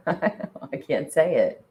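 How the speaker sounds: helpless laugh through the words; cracking up at your own tongue-tie. Real, not acted. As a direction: giggle out the words slowly. Cracks up over unsayable word.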